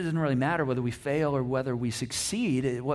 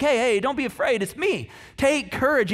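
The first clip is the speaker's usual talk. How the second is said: raised-pitch imitation of calling across water; 8 semitones higher, 5 dB louder than usual. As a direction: call out reassurance, lightly acted. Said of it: "Jesus calls out to him".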